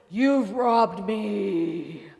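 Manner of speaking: big, slow, booming voice of God; booming Moses voice. Retold or imitated. Pastor mocks the angry God voice, slow booming. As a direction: boom it out like an old Moses movie. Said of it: "it's that big, that voice".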